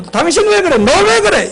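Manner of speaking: high-pitched mock accusation; accusing. Spouse blames the other with a you-message, accusing. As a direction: act out an attack on the other person. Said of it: "상대방을 잘못했다고 공격하는".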